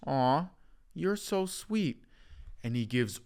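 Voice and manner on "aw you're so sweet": patronizing coo. Acted. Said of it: "He goes".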